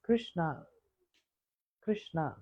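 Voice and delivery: lightly voiced parrot echoing Radharani; parrot calling softly. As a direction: breathe the name lovingly. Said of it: "And parrot saying".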